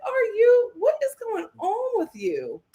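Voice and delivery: high, stretched out, almost wailing; a pleading, baffled outburst; exasperated disbelief. Retold or imitated. Host reliving her shock at Coco's words, incredulous. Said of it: "I was like".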